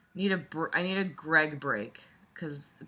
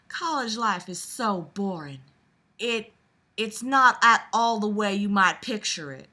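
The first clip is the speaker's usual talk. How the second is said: southern belle; 5 semitones higher, 6 dB louder than usual. Acted. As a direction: read it as a dainty Southern belle. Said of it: "I'll do this reading all in Southern Belle voice".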